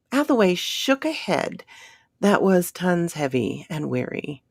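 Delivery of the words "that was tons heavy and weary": usual speaking voice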